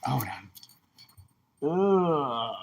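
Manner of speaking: ordinary delivery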